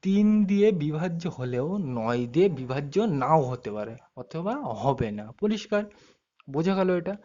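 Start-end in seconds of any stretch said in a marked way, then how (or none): 0.0-5.3 very slow, drawn-out dictation. Shown as a demonstration. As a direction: dictate it slowly for students to write down. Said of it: "আমি আস্তে আস্তে বলছি".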